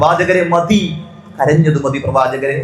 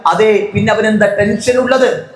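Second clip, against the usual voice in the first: high, raised voice driving the point; fiery preaching; 5 semitones higher than usual. Real, not acted. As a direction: preach it at full force. Preacher rises to full force.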